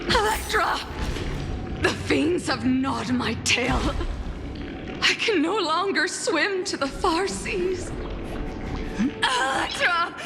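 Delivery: high, desperate cries to a companion; distressed cry. Acted. Wounded siren cries out to Electra.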